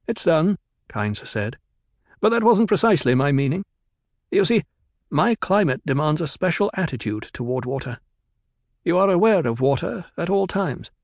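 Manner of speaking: formal and guarded, quick; Kynes, stiff planetologist. A narrator's reading. Kynes on water.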